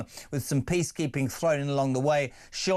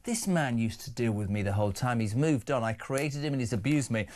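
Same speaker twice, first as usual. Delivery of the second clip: ordinary talk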